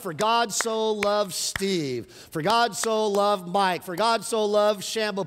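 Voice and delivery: very slow, each name dwelt on; slow scripture read. Acted. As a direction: let each name land. Inserting names into scripture.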